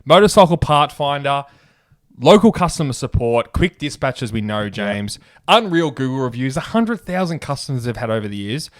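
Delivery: loud, punchy radio ad read. Acted. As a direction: sell it like a hype ad. Reads sponsor ad.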